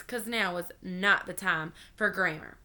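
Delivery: ordinary delivery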